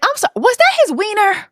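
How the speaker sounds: high incredulous question; shocked. Real, not acted. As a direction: ask it in high disbelief. Shocked aside.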